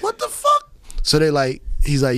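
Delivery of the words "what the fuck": high startled question; high bewildered what the fuck; shocked. Retold or imitated. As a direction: squeak it in mock alarm. Reacting to crowd at door.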